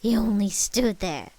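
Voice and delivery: loud, drawn-out accusation; accusing yell. A narrator's reading. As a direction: yell it slowly at the culprit. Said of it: "Marcel's grandmother yelled as she pointed".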